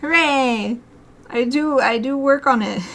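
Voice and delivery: ordinary talk, nothing marked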